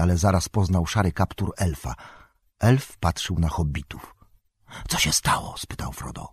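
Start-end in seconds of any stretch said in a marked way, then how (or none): 4.9-5.5 whispering. A narrator's reading. Frodo wakes to danger at night.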